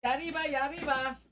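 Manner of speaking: tiny high falsetto cheer; high mock yell. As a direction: squeak it out in a silly high voice.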